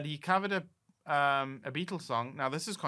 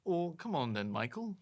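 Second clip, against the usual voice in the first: attempted Liverpudlian accent, slightly raised; 4 semitones higher than usual. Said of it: "can you not do a Liverpudlian accent".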